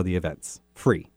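usual speaking voice

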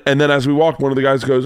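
ordinary delivery

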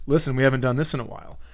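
ordinary delivery

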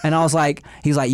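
ordinary talk, nothing marked

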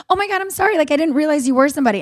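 high, rushed imitation of apologizing; imitated flustered sorry, high then racing; mock apology. Mimics the woman's rushed apology.

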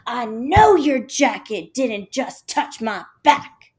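a rude stranger's slow cutting put-down; snotty snap. Retold or imitated. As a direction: sneer it slowly like a stuck-up girl. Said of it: "just like that".

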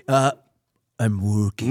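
very low, sluggish mumbled reply; exaggerated deep celebrity-style drawl; mock Andy impression. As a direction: imitate him in a deep, self-serious voice. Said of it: "He goes like this".

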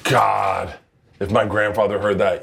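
normal talking voice

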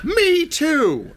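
very high, drawn-out character cry; eager poop smith. Acted. Poop smith agreeing about his bad brother, high eager cry. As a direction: chime in high and loud-spirited.

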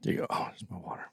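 unvoiced aside to himself; whisper. Real, not acted. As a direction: whisper it under the breath.

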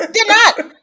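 laughing emphasis. Real, not acted. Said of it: "I did not expect that".

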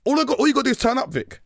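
high, loud and fast; gleeful rushed high burst; excited rush. Retold or imitated. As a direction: rattle it off giddily. Excited quick retelling.

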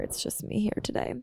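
soft unvoiced whisper; whispered half to herself; whispered aside. Real, not acted. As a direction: whisper it like a private joke. Aside while untangling wires.

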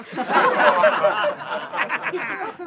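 high breathless laughing fit. Real, not acted. Speaker reliving the car-door hit, laughing. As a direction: laugh helplessly at your own bad luck.

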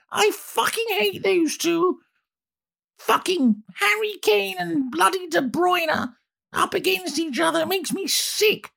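very high, far-off mock royal lady voice; shrill-free high parody of an old queen swearing; imitating the Queen. Palace staff overhear the Queen cursing the fixture, high mock voice. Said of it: "people in Buckingham Palace said they would hear her once a year going".

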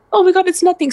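high, startled cry of fear; as if you just turned and saw nothing there; retold fright. Retold fright, empty room.

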